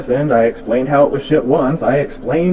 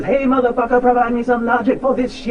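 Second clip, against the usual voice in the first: mock challenge; 7 semitones higher than usual. Mocking paraphrase of own challenge.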